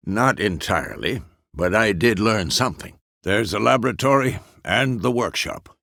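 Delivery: ordinary talk, nothing marked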